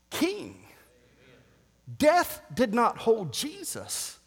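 normal talking voice

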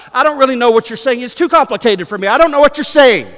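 high, loud, quick protest; feigned ignorance. Acted. Preacher playing someone who denies Jesus by obfuscating, protesting ignorance. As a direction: plead ignorance in a rush.

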